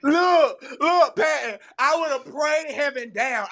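loud and high, excited. Real, not acted. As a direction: burst in with excitement.

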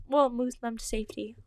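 ordinary delivery

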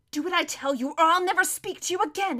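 fierce, pressing ultimatum; hissed threat. Acted. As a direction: threaten her through a tense hiss. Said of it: "hissed Erebus".

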